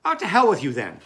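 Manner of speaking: a sudden loud fed-up snap; exasperated outburst. Acted. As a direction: throw the line away in disgust. Andy's letter ends in anger.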